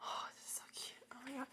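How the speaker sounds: soft breathy whisper; awed whisper. Real, not acted. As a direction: breathe it out in awe. Hushed awe.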